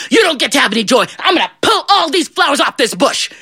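high, emphatic threat; angry devil. Acted. Devil threatens John's flower bush, angry.

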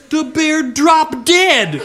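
high, slow, drawn-out punchline; slow dramatic reveal. A narrator's reading. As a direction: savor the surprise.

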